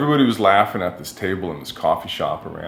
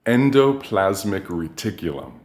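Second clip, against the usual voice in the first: slow, relishing each syllable of Endoplasmic reticulum; savoring a favorite word. Shown as a demonstration. The artist savoring the word he learned in eighth grade, slowly. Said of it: "this fantastic word".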